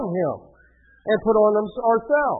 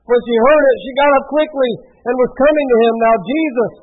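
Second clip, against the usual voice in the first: projected, emphatic Bible reading; scripture reading; 5 semitones higher, 7 dB louder than usual. A narrator's reading. Preacher reads Jesus promising life to Martha, projected scripture reading. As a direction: declaim the passage to the room.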